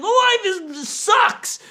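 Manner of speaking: put-on high whiny kid voice; sulky kid. Pastor imitating a boy whose mood has crashed. Kid's mood swing, acted low.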